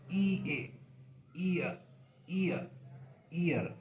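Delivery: ईयर glided from ई to अ, said slowly; diphthong demo. Shown as a demonstration. Teacher voicing the sound for the class, gliding ee to uh. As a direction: slide the tongue from ee to uh in ईयर. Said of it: "इसकी आवाज़ लगाएं तो क्या होगा".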